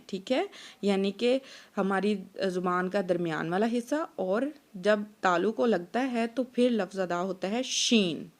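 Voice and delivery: normal talking voice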